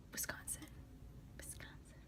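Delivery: very soft whispered Wisconsin. Shown as a demonstration. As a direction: mouth the word to yourself. Whispered self-test.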